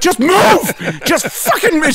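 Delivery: high-pitched mock teacher's yell; imitated frantic teacher; exasperated order. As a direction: order the kids away urgently. Teacher urgently ordering kids, retold.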